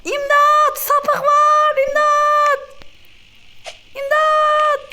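high, loud panicked screams; terrified cries for help; screaming for help. Acted. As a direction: shriek for help as if chased. Screaming for help, fleeing attacker.

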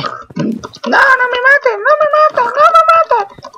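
very high, slow begging piggy squeal; squeaky pig voice. Pretend. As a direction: plead for your life as a tiny piggy. Voices the pig being killed. (cerdito suplicando con voz muy aguda y lenta)

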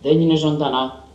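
with certainty. A narrator's reading. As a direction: settle the question with confidence. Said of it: "με σιγουριά".

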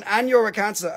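ordinary delivery

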